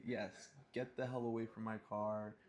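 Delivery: ordinary delivery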